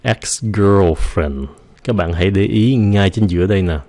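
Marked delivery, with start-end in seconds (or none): 0.0-1.4 put-on ex-girlfriend stretched very slowly; slow drill. Shown as a demonstration. Teacher slowly models ex-girlfriend for learners. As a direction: draw out every sound of ex-girlfriend. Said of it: "chú ý là cái âm này".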